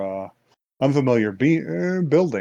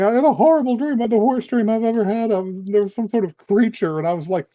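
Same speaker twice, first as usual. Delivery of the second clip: put-on frightened girl; 8 semitones higher than usual. Girl waking from nightmare.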